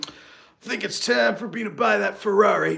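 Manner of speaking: brash husband announcing a purchase, lightly mocked. Husband announcing the Ferrari to his wife, mock brash.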